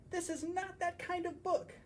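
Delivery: very high, odd creature voice; squeaky banana. Acted. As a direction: say it in a silly squeaky character voice. Banana creature repeats refrain.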